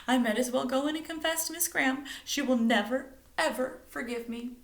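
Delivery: high, tearful despair. A narrator's reading. Stella despairing to her friends, tearful. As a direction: near tears. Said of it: "choking back the tears".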